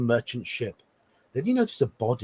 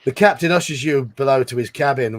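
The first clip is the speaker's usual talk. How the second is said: ordinary talk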